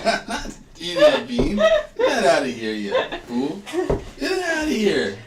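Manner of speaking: high, loud laughing refusal; laughing protest. Real, not acted. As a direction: laugh and push it away.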